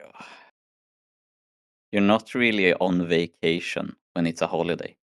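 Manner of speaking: usual speaking voice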